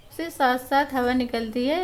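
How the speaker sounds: normal talking voice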